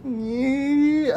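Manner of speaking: high, drawn-out creepy "nyeh"; mock horror-movie squeal; imitated eerie noise. Imitates woman looming in window.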